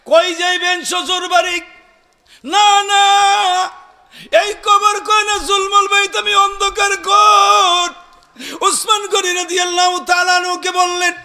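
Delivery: high, loud chanted exhortation; full-force preaching. Real, not acted. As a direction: draw out the calls and ring them out to the crowd.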